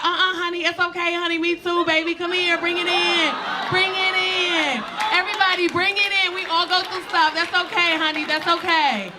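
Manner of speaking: high-pitched, gushing comforting impression. Flight attendant consoling crying passenger, high effusive comforting.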